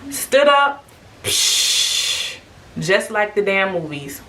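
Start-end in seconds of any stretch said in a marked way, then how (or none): 1.2-2.4 sharp hissy "psh" of gushing water; imitated water gush. Telling how the water broke, imitated gush. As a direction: make a splashy burst sound.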